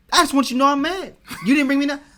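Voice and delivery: high, loud, laughing mock street tough; hammed-up aggrieved partner. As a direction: play the ready-to-fight partner for laughs. Mock partner complaining again.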